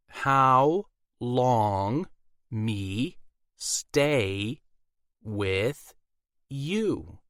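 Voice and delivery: slow word-by-word pidgin, as to a foreigner; mock-simple talk to someone who doesn't understand; broken, deliberate speech. A narrator's reading. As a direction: spell it out word by word. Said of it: "She made hand signals, like she was trying to communicate".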